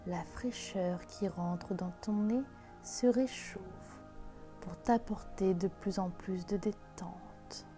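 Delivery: slow, soft meditation voice; guided meditation. Acted. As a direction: guide them into calm, gently. Leads a guided visualisation.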